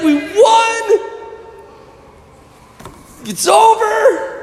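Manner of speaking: high-pitched, incredulous exclamation; squeaky, drawn-out mock dismay; deflated disbelief. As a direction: act out a champion stunned that it's over. Imagined champion the day after winning.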